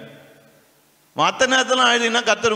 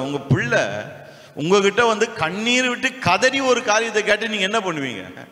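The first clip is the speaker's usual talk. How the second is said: normal talking voice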